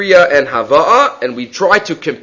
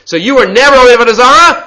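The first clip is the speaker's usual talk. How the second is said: suddenly higher and louder, triumphant; excited punchline; 8 semitones higher, 6 dB louder, faster than usual. Real, not acted. Teacher driving home the Rambam's point to students, higher and louder. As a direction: land the line with a burst of energy.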